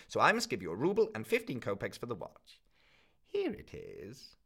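a miserly crone laying down the rules; the pawnbroker telling the student his pledge has lapsed, coldly; stern old pawnbroker. Acted. Old woman counts the money.

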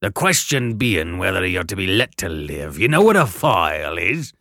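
loud rough convict threat; threatening convict. Acted. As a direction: menace the boy.

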